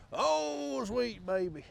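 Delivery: high, loud southern exclamation; drawn-out, high-pitched southern wail; southern drawl. Pretend. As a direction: cry out in a hammed-up southern drawl. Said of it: "this southern drawl keeps getting more gruff".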